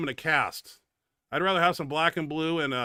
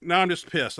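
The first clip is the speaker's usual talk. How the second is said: normal talking voice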